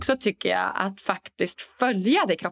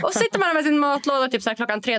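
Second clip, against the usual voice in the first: normal talking voice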